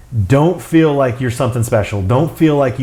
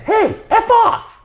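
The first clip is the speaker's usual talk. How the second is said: tiny squawky parrot voice, very high pitched; little voice; slower than usual. Retold or imitated. Parrot behind a man posing for photos, little high voice. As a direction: imitate a little talking bird, pitched way up. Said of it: "And he says it again".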